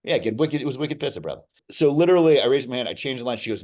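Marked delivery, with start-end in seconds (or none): 0.0-1.4 a hint of Boston accent, playful. Guest answering the host's Boston riff, lightly accented. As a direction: tosses back Boston slang with a grin.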